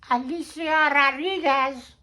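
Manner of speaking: put-on Alicia Rodriguez in a high, slow, Spanish-accented voice; Mexican Spanish accent. Names her in Spanish style.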